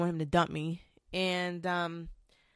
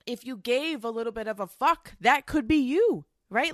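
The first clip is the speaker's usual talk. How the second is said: angry retort; 6 semitones higher, 4 dB louder than usual. Retold or imitated. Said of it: "I was so angry when he said that".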